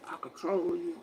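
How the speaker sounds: low, very soft ghostly imitation. Repeating what the ghost man said to her, low hushed voice. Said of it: "That man said".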